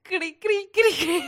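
cricket chirps. Pretend. Crickets after a failed joke.

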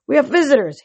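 high, loud sharp bark. A narrator's reading. Hades interrupting the bickering women, barked. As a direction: bark it out to cut off the squabble. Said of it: "Hades barked".

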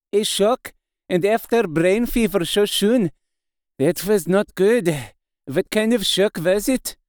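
put-on foreign-accented professor, concerned questioning; accented old doctor, concerned. Doctor asks Mina about the shock, concerned accented voice.

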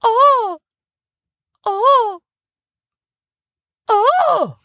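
piercing falsetto "Oh!"; high mic check squeal. Real, not acted. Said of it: "I'm gonna do a new mic check".

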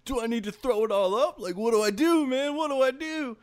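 high-pitched mock panic; paranoid. Stoned patient before surgery asking himself, paranoid. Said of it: "got all paranoid".

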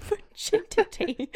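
put-on laughing through a high British accent; put-on British voice cracking up with laughter; giggly, high-pitched mock British. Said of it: "should I do it in a British accent?".

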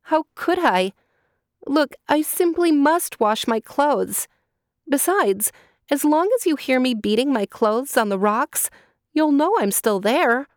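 put-on a captive girl coaxing her master; pleading girl. Girl pleads with the condor to go wash, high and urgent.

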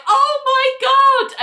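high, loud, drawn-out amazement; slow squeal of disbelief and delight; excited exclamation. Retold or imitated. Annie reacting to her friends qualifying, excited exclamation.

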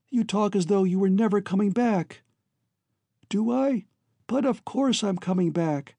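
high-pitched, tender, urgent voice; earnest siblings parting. Acted. Voices brother and sister parting.

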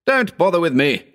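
high, urgent command. A narrator's reading. Wounded Howard scorning his friend's aid, commanding. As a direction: wave off help in a high, strained order. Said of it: "he commanded".

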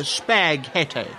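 spaghetti sounded out slowly as "spag-hetty"; slow, puzzled misreading of spaghetti; mispronounced. Acted. Diner puzzles over a strange word, mispronouncing spaghetti slowly. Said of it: "That's how you pronounce it".

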